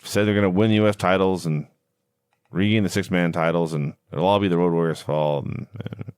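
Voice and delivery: low monotone drone, fading out; incredibly boring manner. Retold or imitated. Host recapping a boring wrestling promo, droning in boredom. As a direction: imitate a dull promo, trailing away. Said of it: "in an incredibly boring manner".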